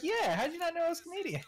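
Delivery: laughing Canadian coworker, lightly imitated; Canadian accent. Canadian colleague surprised he didn't know, in a laughing Canadian accent. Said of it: "you were Canadian".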